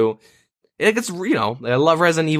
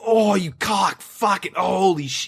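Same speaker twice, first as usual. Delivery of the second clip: angry swearing; 6 semitones higher, slower than usual. Retold or imitated. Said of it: "I just start swearing and getting really angry".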